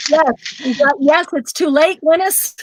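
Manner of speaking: teasing, high sing-song rise; playful teasing. Real, not acted. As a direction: tease her name up high. Teasing friend about late time.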